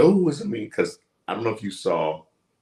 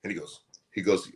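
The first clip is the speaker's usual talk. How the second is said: usual speaking voice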